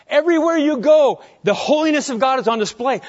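loud, high, building proclamation; swelling pulpit proclamation; rising preaching fervor. Real, not acted.

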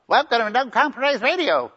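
put-on high, childish radio host voice; twelve-year-old voice. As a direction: play a kid hosting the radio. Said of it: "he's gonna sound like a twelve-year-old".

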